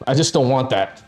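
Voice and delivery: normal talking voice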